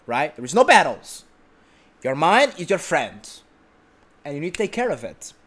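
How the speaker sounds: usual speaking voice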